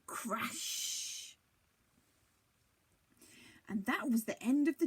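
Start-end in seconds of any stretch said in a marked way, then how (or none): 0.0-1.4 breathy crash. A narrator's reading. Giant crashes down.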